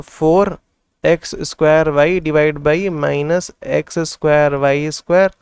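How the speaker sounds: very slow, word by word as if writing it down; slow dictation. Shown as a demonstration. Teacher dictating the term while writing it, very slowly. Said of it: "तो हम लोग लिख लेते हैं".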